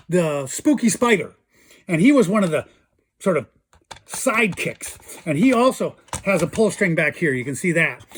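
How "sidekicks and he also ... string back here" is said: normal talking voice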